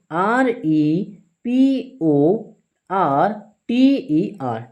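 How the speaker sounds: letters spelled out one by one, very slowly; slow letter-by-letter spelling for pupils. Shown as a demonstration. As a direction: spell each letter with a pause for the class. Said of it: "spelling a word letter by letter".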